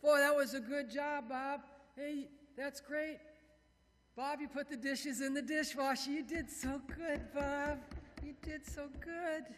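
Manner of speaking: high, cooing, stretched-out compliments; gushing praise. Acted. People praising Bob for the dishes, high gushing praise. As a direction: praise a grown man like a little child. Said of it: "I still like people to say".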